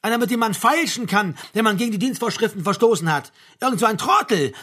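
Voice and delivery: high, loud angry rant; outraged officer raising his voice. A narrator's reading. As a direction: build indignantly to a bellow. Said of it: "Der Major wurde wieder lauter".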